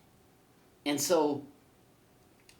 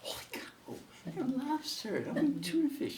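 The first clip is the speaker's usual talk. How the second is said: Harry dismayed; 13 semitones higher than usual. Acted. Harry jealous of hell's lobster.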